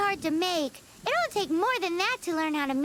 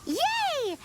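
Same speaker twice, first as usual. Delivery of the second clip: happy cheer; 8 semitones higher than usual. Acted. Cheering about food.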